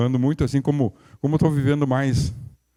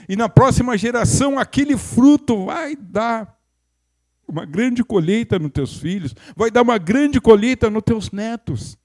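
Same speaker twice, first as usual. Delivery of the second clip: rising higher and louder, preaching fervor; building preaching; 6 semitones higher, 4 dB louder than usual. Acted. Preacher promising a harvest to the congregation, fervent and rising. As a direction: build each promise up to the grandchildren.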